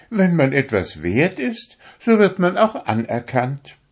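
high, proud little needle voice; a needle showing off how fine it is; vain darning needle. A narrator's reading. To the fingers, a vain needle in a high proud voice. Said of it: "sagte die Stopfnadel".

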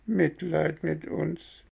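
very high, slow and drawn out; slow whimpering echo. Acted. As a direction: echo it like a pitiful cat. Cat echoes the fox.

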